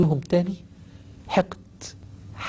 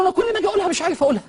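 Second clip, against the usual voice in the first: desperate; much faster than usual. Retold or imitated. Dying man cannot say it.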